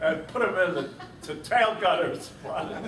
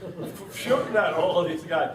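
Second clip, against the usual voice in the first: ordinary talk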